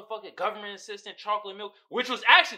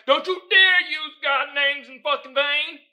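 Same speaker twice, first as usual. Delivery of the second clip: high, loud, slow indignant imitation; hammed-up religious outrage; mock angry scolding; 6 semitones higher, 9 dB louder than usual. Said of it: "they gonna be angry".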